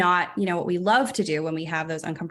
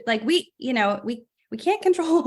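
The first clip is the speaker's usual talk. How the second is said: usual speaking voice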